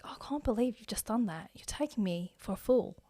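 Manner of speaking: softly acted Australian reality-show accent. Shown as a demonstration. Imitating Married at First Sight contestants, wounded Australian accent. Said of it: "Let's give you Australian".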